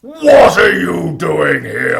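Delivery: big nasty giant bellow; giant's shout. Acted. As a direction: roar at the children. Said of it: "giant's big, nasty voice".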